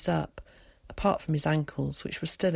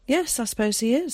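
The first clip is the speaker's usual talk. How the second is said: put-on higher-pitched, musing boy's reply; thoughtful; 7 semitones higher, 3 dB louder than usual. A narrator's reading. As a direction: muse lightly over the answer. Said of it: "said Zack thoughtfully".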